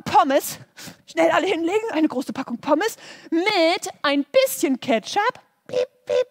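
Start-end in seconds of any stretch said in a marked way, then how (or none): 5.7-6.3 beeping till. Acted. Till beeps at McDonald's.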